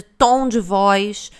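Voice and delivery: ordinary talk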